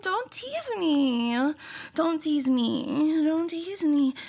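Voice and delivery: slow begging. Acted.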